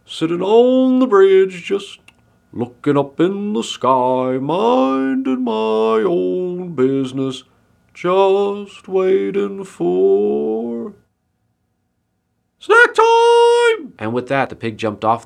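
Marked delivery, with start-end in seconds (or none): 0.0-10.9 high, loud, stretched-out sing-song; idle pig. A narrator's reading. Pig innocently passing time on the bridge, drawn-out high voice. 12.6-13.9 very high, loud squeal; gleeful pig shout. A narrator's reading. Pig pouncing on the woman's basket, squealed war cry.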